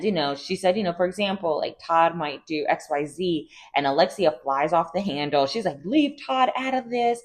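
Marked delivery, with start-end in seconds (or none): 5.8-7.2 retold yell. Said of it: "She's yelling".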